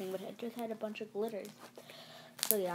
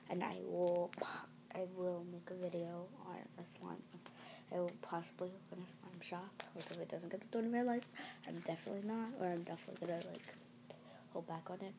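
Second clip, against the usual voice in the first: hushed whisper so nobody wakes; library whisper; 6 dB quieter than usual. Real, not acted. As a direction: whisper softly, people sleeping nearby. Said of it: "like, whispering".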